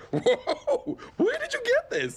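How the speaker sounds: high laughing exclamation; giddy laughing. Acted. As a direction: laugh and squeal in surprise. High after snorting, laughing.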